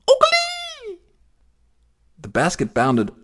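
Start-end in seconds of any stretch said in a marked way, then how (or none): 0.1-1.0 very high chirping bird call; birdsong. A narrator's reading. Blackbirds in the willows sing out high. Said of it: "blackbirds singing".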